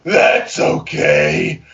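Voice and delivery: very low, slow sultry purr; seductive voice. Acted. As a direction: purr it as seductively as you can. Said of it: "in the most seductive voice I can manage".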